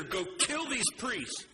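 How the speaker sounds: high, slow, angry royal command; acted wrathful king's order; lashing out angrily. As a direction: order it in anger, drawing out the words. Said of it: "He lashes out in anger".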